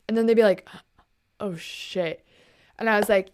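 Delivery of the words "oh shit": much softer and lower; mock sheepish realisation. Streamers realising who just gifted them, mock sheepish. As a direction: drop to a small, low voice.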